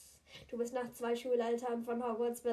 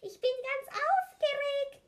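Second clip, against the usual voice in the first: put-on excited child; 13 semitones higher, 4 dB louder than usual.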